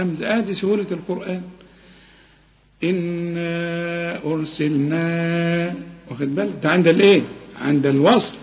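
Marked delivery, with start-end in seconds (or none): 2.8-5.7 slow, softer chanted إنا أرسلنا; soft recitation. Shown as a demonstration. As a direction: recite quietly with long madd. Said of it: "repeats recitation demo".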